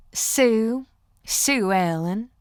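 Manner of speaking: put-on drawn-out Southern country accent. Playfully giving a fan a false name, country accent. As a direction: play up a slow Southern twang. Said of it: "in my best country accent".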